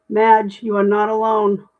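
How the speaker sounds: ordinary talk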